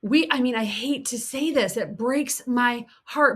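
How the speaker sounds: ordinary talk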